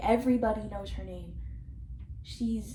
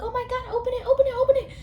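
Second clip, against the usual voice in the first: higher and building louder, eager squeal; mock excited friends; 12 semitones higher, 5 dB louder, much faster than usual. Vlogger imitating friends begging Barbie to open the letter, excited squeal. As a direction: beg her to open it, getting louder. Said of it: "they're like".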